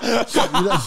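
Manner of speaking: high laugh over the words; breaking up mid-sentence. Real, not acted. Recalling the waiter's question, laughing.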